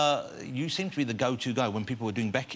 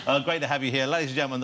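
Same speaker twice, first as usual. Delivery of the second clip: normal talking voice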